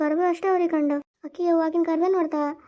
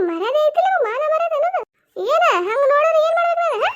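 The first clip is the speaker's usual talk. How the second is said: angry scolding; 8 semitones higher than usual. Acted. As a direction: tear into her for climbing the tree. Mother-in-law scolding daughter-in-law.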